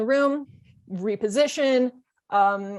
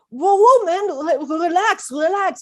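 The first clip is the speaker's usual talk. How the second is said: high, loud whoa to calm him; startled director calming a fight; alarmed backing off; 7 semitones higher, 5 dB louder, slower than usual. Retold or imitated.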